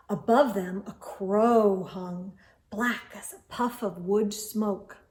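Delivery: usual speaking voice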